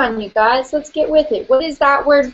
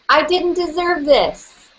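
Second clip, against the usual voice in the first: higher, slow, leaning hard on didn't; emphatic grievance; 6 semitones higher than usual. Shown as a demonstration. Group repeats their hurt word with emphatic grievance. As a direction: push the grievance out. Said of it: "say it five times in a row".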